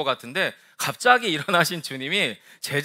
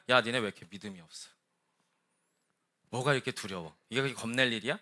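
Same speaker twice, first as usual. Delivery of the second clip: low, quiet scolding; acted belittling tone, softly dropped; dismissive reproach; 6 semitones lower, 9 dB quieter, faster than usual. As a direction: chide frightened followers as if it were nothing. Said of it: "이런 뉘앙스라면 여러분".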